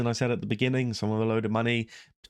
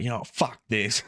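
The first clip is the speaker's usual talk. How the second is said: Frank Warren impression; 3 semitones higher than usual. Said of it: "Imagine Frank Warren turned around and just said that".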